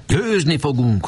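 high, slow rallying cry; eager battle cry. A narrator's reading. Boys rallying each other before the battle, high and eager. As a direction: shout the victory cry up high. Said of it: "hallatszott mindenünnen".

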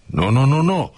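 wag a finger with your voice; finger-wagging no. Retold or imitated. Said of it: "csóválva az ujját".

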